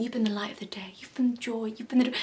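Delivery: breathy fawning hush; mock gushing whisper. Vlogger mocking interviewers, soft patronising whisper. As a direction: whisper like to a toddler. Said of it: "when they're at the interview".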